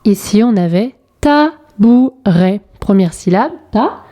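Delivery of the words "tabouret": "tabouret" split into ta, bou, ret; syllable by syllable. Shown as a demonstration. Teacher helping rebuild the word, syllable by syllable. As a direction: sound out "tabouret" in three slow beats. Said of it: "en coloriant les bonnes syllabes".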